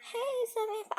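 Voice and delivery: high, shaky nervous laugh-cry; scared friend greets Samantha nervously; nervous laughing greeting. Retold or imitated. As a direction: force a frightened cheerful hello. Friend nervously greets the creepy girl.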